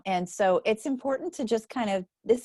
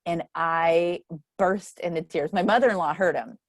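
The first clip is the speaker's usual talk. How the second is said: usual speaking voice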